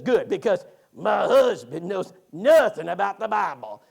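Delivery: loud, slow, raspy imitation of a wife; raspy put-on complaint, drawn out and loud. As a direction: rasp it out, stretching the words. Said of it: "she says it raspy like that".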